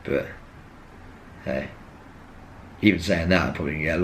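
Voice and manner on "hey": ordinary talk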